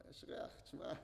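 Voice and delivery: discreet whispered aside. Retold or imitated. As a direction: whisper it so others won't hear. Rabbi tells student he smells.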